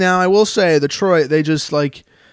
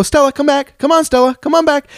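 high-pitched call after a running dog; kids calling the dog; 10 semitones higher than usual. Retold or imitated. Neighbourhood kids calling their dog Stella back, high and eager. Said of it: "I hear them go".

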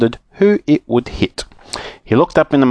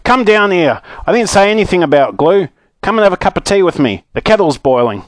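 higher, insistent calling, partly hurried; cried out; 7 semitones higher than usual. Acted. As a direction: call a friend down with a raised pitch. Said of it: "cried Mister What's-his-name".

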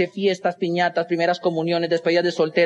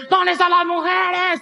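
high, drawn-out crowd call; slow, high-pitched MC holler; fairground hype man; 9 semitones higher, slower than usual. Acted. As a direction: work the crowd like a party emcee. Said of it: "de esos que salen en las ferias".

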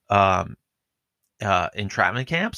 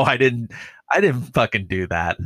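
ordinary talk

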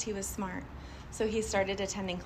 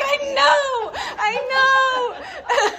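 high, loud, laughing through the words; laughing reply; 8 dB louder, slower than usual. Retold or imitated. Answering the mirror exercise, laughing high and loud. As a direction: laugh it off while admitting it. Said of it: "I was like".

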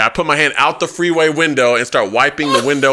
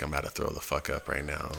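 hushed low key murmur, much softer; quiet as fuck; 9 semitones lower, 18 dB quieter than usual. Retold or imitated. Drunk in an Uber, telling himself quietly he's about to throw up. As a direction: keep it down so the car can't hear. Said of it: "Low key and quiet as fuck".

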